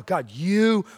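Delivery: slow, hushed reverent address; reverent prayer. Shown as a demonstration. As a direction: pray it slowly and humbly. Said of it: "we come before God and go".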